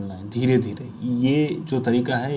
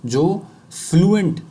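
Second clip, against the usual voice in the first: Fluent stretched and said higher and louder; stressed fluent; 6 semitones higher, 4 dB louder, much slower than usual. Shown as a demonstration. Coach stressing what students want to become. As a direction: lean hard on fluent. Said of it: "बोलना चाहते हैं".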